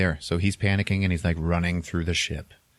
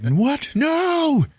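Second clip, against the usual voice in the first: high, loud cry of disbelief; shocked outburst; 4 dB louder than usual. Retold or imitated. Passenger hearing the trip takes ninety years, high loud shocked cry. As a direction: yelp it out in horror. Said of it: "And he's like".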